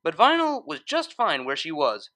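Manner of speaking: plain reading voice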